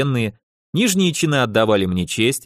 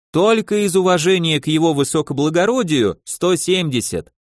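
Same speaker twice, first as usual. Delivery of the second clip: wheedling tailor with a Yiddish lilt; yiddish accent russian; 3 semitones higher than usual. Acted. Tailor haggling. (лёгкий идишский акцент портного)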